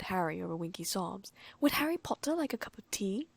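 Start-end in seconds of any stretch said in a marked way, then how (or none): 1.6-3.3 high squeaky elf voice; squeaky house elf. Acted. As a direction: squeak it out, bubbling with joy. Dobby offers tea, high voice.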